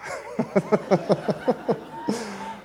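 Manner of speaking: airy silent laugh at the memory; breathy laugh. Real, not acted. Preacher laughing at his wife's line, airy laugh. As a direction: let a soundless laugh escape.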